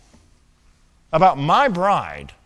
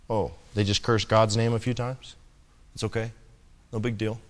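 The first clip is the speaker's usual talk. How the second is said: softer, shrugging it off; dismissive shrug; 5 semitones lower, 6 dB quieter than usual. Retold or imitated. Complacent moviegoer excusing cursing in films, mock shrug. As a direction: brush it aside like it's nothing. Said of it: "Oh, it's only rated".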